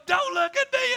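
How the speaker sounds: high-pitched mock shout; yelling drill instructor. Drill instructor tormenting a weeping recruit, mock shouting. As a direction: holler the order like an instructor. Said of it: "He'd be yelling and screaming".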